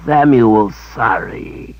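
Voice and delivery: a sheepish creature sorry. A narrator's reading. Creature servant apologising to his mistress, sheepish.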